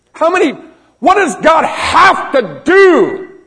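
very high, drawn-out preacher's cry; impassioned shout. Real, not acted. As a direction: cry it out in exasperation, slow and loud. Preacher's exasperated plea to congregation.

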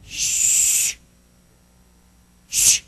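long hushing shush. Retold or imitated. Apostles silencing the blind man, drawn-out shush. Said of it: "they tried to shush him up".

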